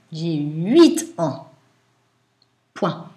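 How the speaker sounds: dictating. Shown as a demonstration.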